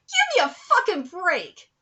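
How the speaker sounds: fed-up exasperated snap; exasperated outburst. Real, not acted. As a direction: blow up at the statement. Ranting at award statement.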